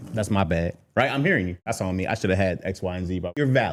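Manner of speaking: calm him down gently; apologetic. Retold or imitated. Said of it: "but I go like".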